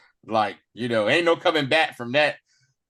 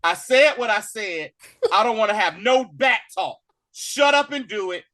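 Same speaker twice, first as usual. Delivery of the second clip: mock strict parent laying down the law; a hint of a scolding father; stern parent; 6 semitones higher than usual. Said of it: "he's like a parent".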